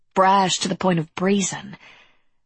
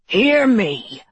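loud, raised growling call; monster growl; 5 semitones higher, 5 dB louder than usual. A narrator's reading. Malkai calling out behind Harker, loud growl. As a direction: growl it out to the whole hall. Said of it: "growled the Malkai behind him".

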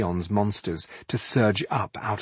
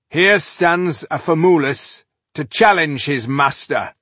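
loud, high challenge; calling out; 7 semitones higher, 6 dB louder than usual. A narrator's reading. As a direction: call out the challenge to the master. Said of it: "he called".

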